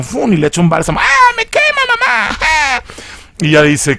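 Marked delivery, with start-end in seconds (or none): 1.0-2.8 high shriek of pain, crying for mom; burning scream. Retold or imitated. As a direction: scream like the balm burns. Possessed girl burned by balm. (grito agudo de dolor)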